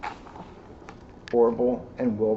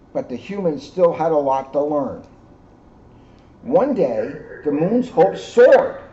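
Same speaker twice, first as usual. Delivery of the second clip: gentle read-aloud storytelling; story narration; 2 semitones higher than usual. A narrator's reading. Reading the moon story to the children, storyteller voice. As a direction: tell it like a bedtime story to kids. Reads picture book to children.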